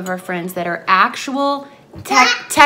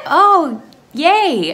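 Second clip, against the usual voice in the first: mock enthusiasm; 7 semitones higher, 8 dB louder than usual. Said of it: "And I'm like".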